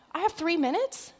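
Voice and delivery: high, rising disbelief; dismayed surprise. Real, not acted. Speaker learning only three minutes are left, dismayed surprise. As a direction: question it in startled protest.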